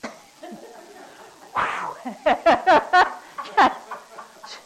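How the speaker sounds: a loud, high laugh that bursts out; real burst of laughter. Real, not acted. Laughing at her own demo.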